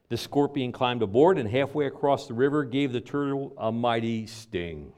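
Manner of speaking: ordinary talk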